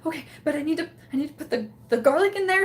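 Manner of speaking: mildly flustered, rising in pitch; retold panic. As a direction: act out getting flustered mid-task. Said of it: "I'm panicking".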